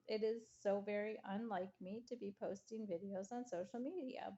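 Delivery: ordinary delivery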